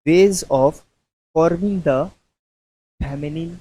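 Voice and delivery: word by word, pausing for students to write; dictating slowly. Shown as a demonstration. Teacher dictating a heading to students, slow and spaced. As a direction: spell out a heading for notes. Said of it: "अब हम कुछ लिखाते हैं".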